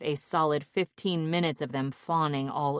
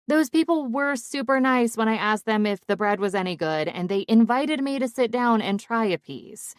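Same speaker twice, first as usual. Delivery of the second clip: higher-pitched self-justification; defensive protest; 6 semitones higher, 4 dB louder than usual. A narrator's reading. Daughter justifying her dare to her parents, defensive. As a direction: protest your innocence in a raised pitch.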